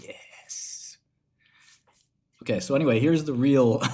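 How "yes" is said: whispered mock-gloating yes; secret triumph. Customer secretly celebrating a worker's firing, gloating whisper. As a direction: hiss it privately, drawn out. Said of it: "Inside, I'm saying".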